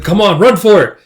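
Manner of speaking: high, loud urging; acted shout to hurry; urgent call. Urging others to run.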